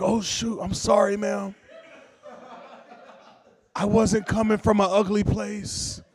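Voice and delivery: high, flustered apology; mortified apology. Acted. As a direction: backpedal after a terrible blunder. Mortified apology to stroke survivor.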